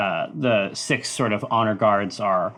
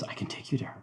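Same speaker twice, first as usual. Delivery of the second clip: unvoiced, much softer whisper; 16 dB quieter, much faster than usual. Kid whispering to the father, unvoiced. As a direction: breathe it secretively.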